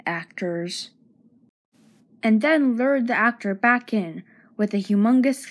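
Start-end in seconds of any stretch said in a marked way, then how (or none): 0.1-0.9 low, soft dismissive mumble; mumbled. Acted. Director to herself after an actor quit, mumbled. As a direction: mumble it like an eye-rolling director. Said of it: "rolled her eyes and mumbled".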